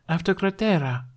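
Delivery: cheerfully unbothered; light-hearted. Acted. Aide repeating to Hiccup, light-hearted. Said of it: "annoyingly light-hearted about the matter".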